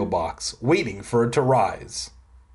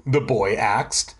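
ordinary delivery, nothing marked

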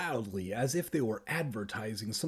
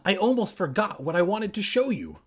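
higher-pitched call over the shoulder; calling back; 7 semitones higher, 4 dB louder than usual. A narrator's reading. Kermit calls back to Evan as he runs off, calling. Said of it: "Kermit called back".

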